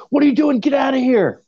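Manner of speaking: very high, shrieking imitation; mock angry yell. Startled singer orders intruder out of dressing room, mock yell. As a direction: screech it like a startled, outraged star. Retold outburst of startled rock star.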